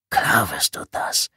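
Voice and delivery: slow, breathy whisper with a threat in it; hissed whisper. Acted. Intruder's last hissed assurance to Tommy, slow whisper. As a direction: hiss it slowly, sure of him. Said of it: "zischte die Stimme ein letztes Mal".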